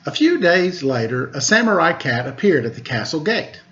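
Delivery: plain reading voice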